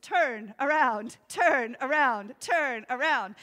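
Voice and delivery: slow, loud, mocking sat-nav repeats; mocking GPS voice. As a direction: taunt like a smug GPS. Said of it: "that voice is so mocking".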